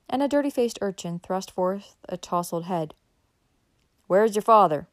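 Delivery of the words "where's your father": loud, brusque question; raised-voice demand; calling out. A narrator's reading. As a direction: call across to the cabin. Horseman shouts to child at cabin.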